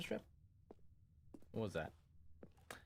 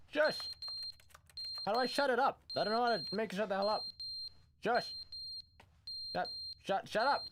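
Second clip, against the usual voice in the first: frustrated yelling; 6 semitones higher than usual. Real, not acted. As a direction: snap at the smoke alarm to shut up. Yelling at a beeping smoke alarm.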